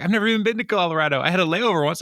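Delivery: high, rushed mock panic; panicked protest. Suspect denying Colorado fraud to officer, panicked. Arrested man panics at new charge.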